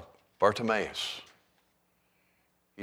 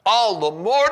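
"all the more" thrown out high and loud; all the more, louder; 11 semitones higher, 9 dB louder than usual. Shown as a demonstration. Preacher building the beggar's cry, much louder.

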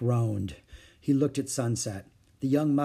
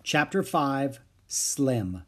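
usual speaking voice

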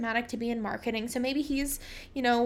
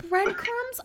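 incredulous; 11 semitones higher than usual. Real, not acted. Incredulous at added breadcrumbs.

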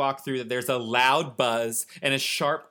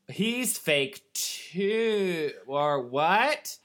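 put-on voice; 5 semitones higher, much slower than usual. Reacting in a put-on voice.

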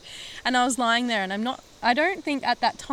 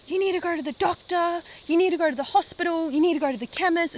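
high, fast imitation of a bossy mum; 6 semitones higher, faster than usual. Mimicking mum listing demands, high and fast. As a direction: rattle off orders like a fussing mother. Mum's bossy advice retold.

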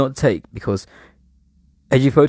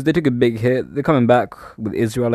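ordinary delivery

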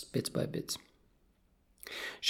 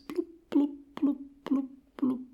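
string of high little bloops; imitated bubble blips; 13 semitones higher than usual. Goldie bubbling between remarks to Kat, high blips. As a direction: blow pretend fish bubbles with your mouth.

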